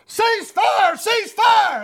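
high, urgent retold shout; hollered battlefield command. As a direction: holler it like a soldier halting gunfire. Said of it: "started hollering out".

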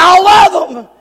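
high, shouted declaration of love; impassioned preacher's shout; fervent promise. Acted. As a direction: cry out the promise with full conviction. God's promise, climax of the series.

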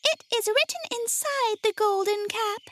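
high, tiny mouse-queen voice; small squeaky storybook queen; squeaky little voice. A narrator's reading. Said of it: "in her squeaky little voice".

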